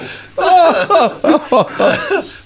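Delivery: high rising burst of laughter; loud laughter. Real, not acted. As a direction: keep cracking up loudly.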